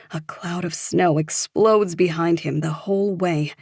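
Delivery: ordinary delivery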